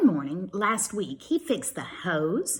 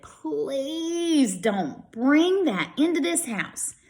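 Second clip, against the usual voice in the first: slow, higher, disgusted mother; disgusted mom; 7 semitones higher, slower than usual. Acted. Mom refusing the dirty bear in the house, disgusted. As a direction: drag it out like a fussy mom.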